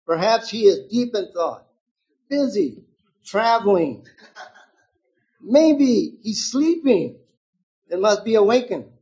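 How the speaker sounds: high, loud mocking singsong; taunting. A narrator's reading. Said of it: "began to taunt them".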